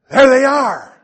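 high, very loud imitation of wife; excited shout. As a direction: blurt it out as a sudden alarm. Wife spots missionaries in store.

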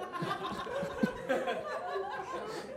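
a high, airless wheeze of laughter; breathless silent laugh. Real, not acted. Laughing at his friend's story, high airless wheeze. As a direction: laugh without voice, high and helpless.

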